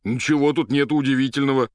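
loud, high, defensive answer; indignant retort. Acted. Patient answers the doctor about his underwear, indignant. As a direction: fire back loudly at the question.

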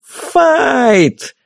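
high straining fight call; straining yell. A narrator's reading. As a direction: yell it at the top of lungs. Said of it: "надрываясь, завопила фея".

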